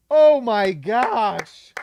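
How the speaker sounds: slow, loud awestruck gasp of words; overwhelmed delight; drawn-out amazement. Real, not acted. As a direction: stretch every word in joy. Celebrating the pick.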